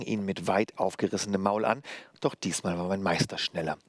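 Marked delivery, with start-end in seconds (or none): none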